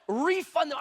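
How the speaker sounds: high, insistent retold demand; shocked and mad. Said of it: "I was so shocked, mad".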